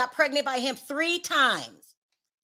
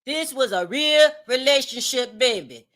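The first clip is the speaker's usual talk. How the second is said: put-on Louisiana accent. Vlogger mimicking the interviewed woman, mock Louisiana accent. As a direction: imitate her Louisiana twang, leaning on "real". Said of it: "this is my Louisiana accent".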